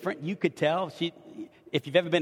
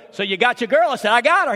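proud excitement; 7 semitones higher, 9 dB louder than usual. Retold or imitated. Booking dinner reservation, proud answer.

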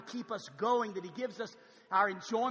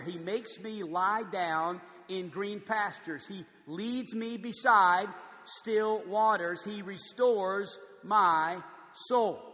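slow, louder, solemn reading of the psalm; slow scripture reading; 5 dB louder, much slower than usual. Acted. Pastor reading the psalm to the congregation, slow and solemn. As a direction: lay each line down slowly and deliberately.

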